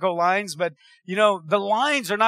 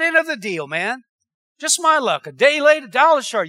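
mock self-pity. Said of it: "when people start talking like this".

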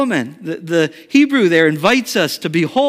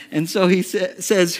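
normal talking voice

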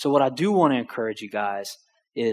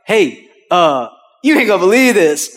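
loud, high, bursting with news; acted breathless-with-news excitement; excited; 5 semitones higher, 9 dB louder than usual. Son phoning dad with amazing news, excited.

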